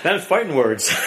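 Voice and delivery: said through laughter; chuckling at the jab; laughing. Real, not acted. Preacher laughs at the husband's insult, laughing. Laughing at his own story.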